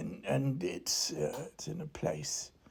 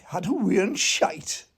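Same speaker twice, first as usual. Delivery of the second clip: mock Geordie accent; 3 semitones higher, 8 dB louder than usual. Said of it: "They say things like".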